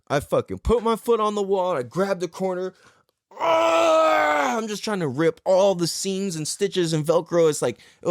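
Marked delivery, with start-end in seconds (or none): 3.4-4.6 long, high effortful cry; acted roar of exertion; straining yell. Seeker ripping velcro seams apart, straining yell.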